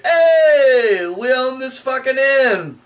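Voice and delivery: high, loud hammed-up welcome; mock innkeepers luring guests in; sleazy innkeeper voice. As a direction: greet them like a shady innkeeper.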